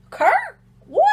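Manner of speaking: very high, loud incredulous yelp; acted shock. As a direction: squeak the question in mock astonishment. Acting friend's shock at father's name.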